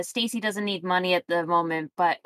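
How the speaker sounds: ordinary delivery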